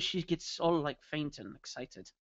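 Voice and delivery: normal talking voice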